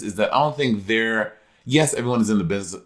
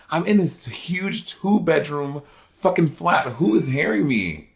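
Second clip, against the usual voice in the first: louder, higher indignant retelling; indignant protest; 6 semitones higher, 4 dB louder, slower than usual. Retold or imitated. Guest retelling his reply to a noise complaint, indignant. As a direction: protest in drawn-out disbelief. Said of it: "I was like".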